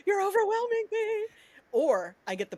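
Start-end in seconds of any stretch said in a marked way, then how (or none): 0.1-1.3 high, squeaky-pitched complaint; mock overwhelmed. Writer protesting her editor's flood of texts, mock overwhelmed. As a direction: plead playfully way up in pitch.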